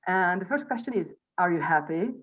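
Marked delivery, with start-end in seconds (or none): none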